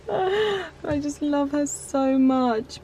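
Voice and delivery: tearful laugh, slow and soft; a tearful laugh into soft words; teary. Real, not acted. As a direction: choke up with love for the dog. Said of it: "I'm so not gonna cry".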